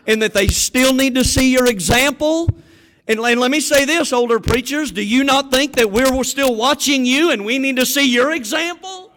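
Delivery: loud, high, fervent pulpit preaching; preaching at full force. Acted. Preacher urging retirees to keep serving, loud fervent preaching. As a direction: drive it home to the congregation, full voice.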